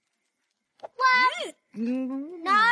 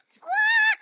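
high, loud parrot screech; shrill bird call; parrot squawk; 13 semitones higher, 5 dB louder than usual. Acted. Playing a parrot.

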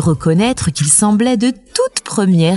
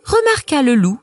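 usual speaking voice

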